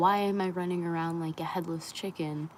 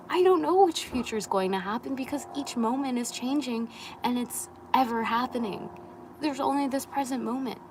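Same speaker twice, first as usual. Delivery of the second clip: building intensity; 6 semitones higher, 4 dB louder than usual. Real, not acted. Passionate rant building up.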